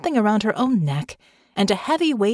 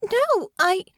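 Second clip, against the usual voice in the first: put-on panicked girl; 14 semitones higher than usual. Honor in panic.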